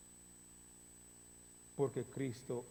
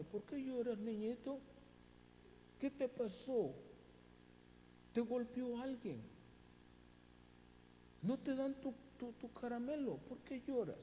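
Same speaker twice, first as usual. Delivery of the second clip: lightly voiced, higher, coaxing talk to a crying child; coddling a child; 8 semitones higher, 3 dB quieter than usual. Shown as a demonstration. An adult soothing a crying child, higher coaxing tone. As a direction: coo at a little one like a fond parent. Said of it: "Como se le hace a un niño".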